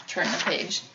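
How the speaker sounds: soft unvoiced reminder; whispered aside. Real, not acted. Reader prompting the child to turn, whispered aside. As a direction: breathe it quietly aside.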